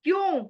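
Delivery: high, pointed question; rhetorical why. Real, not acted. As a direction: fling क्यों? at the listeners. (ऊँचे सुर में सवाल)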